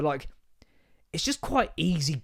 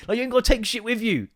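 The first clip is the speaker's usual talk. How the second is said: ordinary talk, nothing marked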